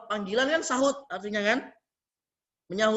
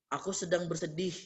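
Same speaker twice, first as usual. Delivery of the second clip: soft and low, sad and faint; plaintive murmur; 5 semitones lower, 9 dB quieter than usual. Shown as a demonstration. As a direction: let it fall away sadly and quietly. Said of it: "Lirih Meta".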